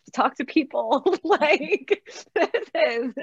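words breaking up into high laughter; laughing. Real, not acted. Cohost admitting anxiety about socializing after the pandemic, laughing.

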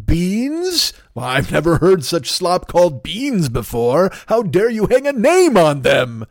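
high, slow, scandalised indignation, voice jumping up on beans; outraged bean critic. Acted. Angry customer scolding the bean company, slow outraged indignation. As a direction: huff it out like an offended connoisseur. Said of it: "I'm angry at the people over there at Bush's".

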